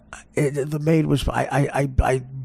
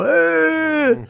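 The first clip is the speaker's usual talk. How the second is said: gorilla roar. Acted. Describing the King Kong ad, mimicking the gorilla's roar. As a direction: bellow like the movie gorilla.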